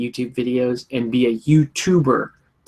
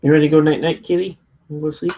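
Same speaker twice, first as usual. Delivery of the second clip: pet talk; 2 semitones higher, faster than usual. Real, not acted. Talking to the cat.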